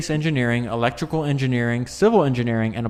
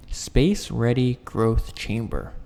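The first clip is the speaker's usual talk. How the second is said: deliberate, measured pace, savoring an odd phrase; slow, careful repeat; slower than usual. Real, not acted. Reader trying a tongue-twisting phrase on listeners, slow and careful. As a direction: spell it out slowly, word by word.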